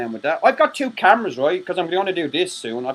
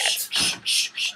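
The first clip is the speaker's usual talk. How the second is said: shushing; 7 dB quieter, slower than usual. Real, not acted. Shushing friends so the brother won't hear. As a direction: hiss a quick unvoiced shush. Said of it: "hushing the others".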